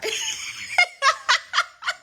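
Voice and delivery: high, loud laugh through tears; teary laugh. Real, not acted. Host laughing through tears at a client's news. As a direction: laugh off the lump in the throat.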